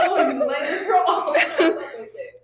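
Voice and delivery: high, laughing retold complaint; mock-mad warning. Co-host retelling how she warned him, laughing mock anger. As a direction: play at being mad while laughing. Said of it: "I was so mad".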